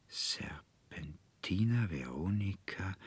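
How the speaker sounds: soft, whispered sigh; sighed to himself. A narrator's reading. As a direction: breathe the names out slowly under your breath. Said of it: "seufzte er in sich hinein". (leise in sich hinein geseufzt)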